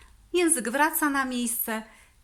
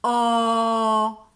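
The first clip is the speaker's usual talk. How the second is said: a long, louder "O" with the lips rounded; loud sustained O; 7 dB louder than usual. Shown as a demonstration. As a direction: project a full "O" like a great speaker. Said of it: "Jeszcze raz pokażę".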